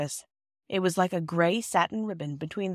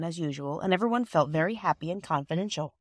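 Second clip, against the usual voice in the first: plain reading voice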